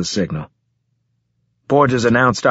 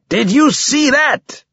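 drawn-out roar, pitched even higher; slower than usual. A narrator's reading. Borges repeating himself to his squadmate, slow stretched roar. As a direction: roar it slower, stretching the words high.

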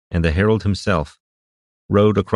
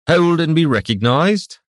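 high, raised formal challenge; herald's shout; 10 semitones higher, 4 dB louder than usual. A narrator's reading. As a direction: call out to halt approaching riders. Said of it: "came the herald's shout".

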